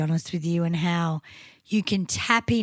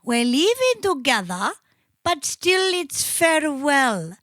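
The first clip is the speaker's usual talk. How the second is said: put-on slightly raised, drawn-out Swedish accent; mock Swedish accent; 4 semitones higher, slower than usual. Reading song lyrics to prove a point, mock Swedish accent. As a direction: read it like a Swedish guy, stretching the ending. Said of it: "it reads like a Swedish guy".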